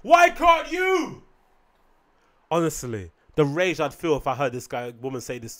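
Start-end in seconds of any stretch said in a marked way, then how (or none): 0.0-1.2 very high, loud, stretched-out retort; outraged mock shout. Comic turning pensioner's question back on her, outraged shout. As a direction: throw the question back, yelling slowly.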